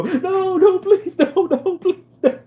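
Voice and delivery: very high, loud, whiny mock plea; hammed-up wailing please-don't-leave-me; mock desperate begging. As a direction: beg like a pathetic dumped boyfriend, hammed-up. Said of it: "he has to go".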